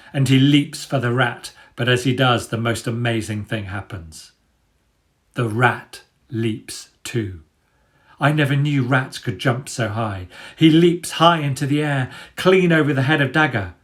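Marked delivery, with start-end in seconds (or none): none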